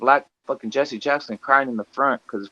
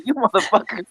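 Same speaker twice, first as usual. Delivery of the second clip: disappointed outburst; 8 semitones higher than usual. Retold or imitated. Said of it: "I was disappointed".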